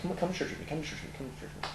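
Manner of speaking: a tired wife's nagging, trailing away; mock pleading wife. Wife nagging him to church.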